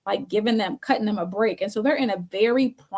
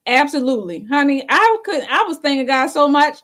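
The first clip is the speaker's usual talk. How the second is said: higher and louder, bursting with gratitude; excited; 5 semitones higher, 6 dB louder than usual. Real, not acted. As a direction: thank God out loud, thrilled. Thrilled over not paying rent.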